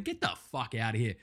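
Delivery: normal talking voice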